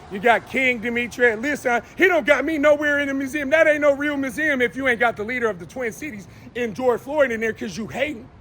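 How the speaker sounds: normal talking voice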